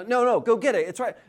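lightly acted parent urging a child on; frustrated father. Father telling daughter to fetch cup, insistent. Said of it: "I'm frustrated".

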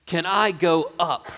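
loud, high-pitched earnest plea; impassioned humble request; pleading second criminal. Acted. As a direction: beg the Lord, drawing the words out. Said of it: "The second guy is saying".

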